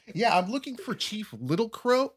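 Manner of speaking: high, polite formal asking; mock earnest visitor. Tourist asking reservation locals for the chief. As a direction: sound like a naive tourist. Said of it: "acted visitor asking".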